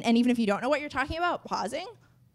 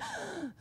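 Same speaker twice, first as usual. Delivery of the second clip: gasping for breath mid-sentence; sharp gasp for air; 15 dB quieter than usual. Shown as a demonstration. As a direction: suck in air like you ran dry. Demo running out of breath.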